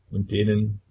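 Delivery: plain reading voice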